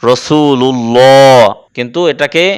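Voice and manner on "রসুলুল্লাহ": 'রসুলুল্লাহ' drawn out to a rounded 'llo'; heavy Allah l. Shown as a demonstration. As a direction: recite 'রসুলুল্লাহ' slowly, thick long 'lloo'. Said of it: "যদি পেশ হয় তখন".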